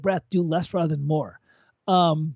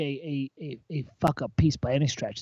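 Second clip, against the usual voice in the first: ordinary talk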